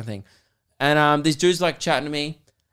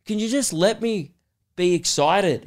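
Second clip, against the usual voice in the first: retold exasperated plea; 6 semitones higher than usual. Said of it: "He's like".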